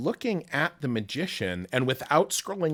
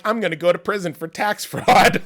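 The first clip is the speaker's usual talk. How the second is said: laughing confession; 8 semitones higher, 9 dB louder than usual. Retold or imitated. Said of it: "I'm like".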